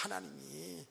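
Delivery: 하나님이 repeated softly as a hushed echo; quiet echo. Shown as a demonstration. Preacher echoing himself to the congregation, soft 하나님이.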